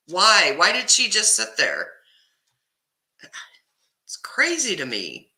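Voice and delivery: ordinary talk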